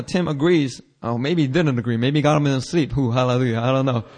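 normal talking voice

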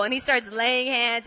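ordinary delivery

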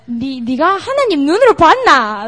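mock Busan-accented mom. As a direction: fire it off fast in a Busan accent. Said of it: "like, Busan accent".

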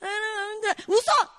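mock tearful whine jumping to a high shout; whimper, then burst into the command; imitating a child switching moods; whining daughter. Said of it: "Then she's like".

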